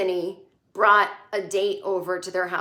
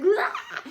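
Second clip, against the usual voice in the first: very high-pitched mock scream; imitated piercing shriek. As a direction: squeal like you're being murdered in a movie. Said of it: "like, shrieking".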